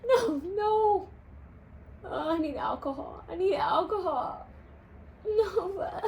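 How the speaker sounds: high, whiny cry of pain; pained whimper. Real, not acted. Owner whines in pain after the dog bumps her mouth.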